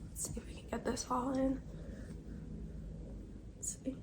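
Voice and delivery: hushed murmur to herself while arranging the sweater; quiet mutter under the breath; whispered aside. Real, not acted. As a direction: drop to a near whisper, just for yourself.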